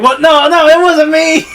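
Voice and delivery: high, loud, hammed-up protest; mock panicked denial. Accused king denying it, mock panic. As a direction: plead innocence in an exaggerated high voice.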